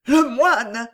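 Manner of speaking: high, loud cry of shock; half-crazed stammer. A narrator's reading. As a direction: blurt it out, half mad. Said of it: "bégaya-t-elle à demi folle". (cri affolé, égaré)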